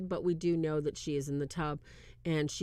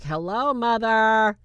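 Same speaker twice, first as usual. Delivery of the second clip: knowing greeting; 3 semitones higher, 8 dB louder, slower than usual. Retold or imitated. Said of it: "she's like".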